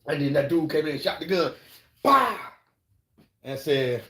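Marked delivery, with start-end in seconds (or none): 2.0-2.4 loud high "Bah!" like a gun going off; gunshot noise. Retold or imitated. Man mimics the gun firing, loud mock bang.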